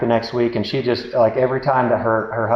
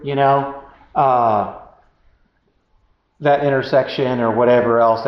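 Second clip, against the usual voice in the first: ordinary talk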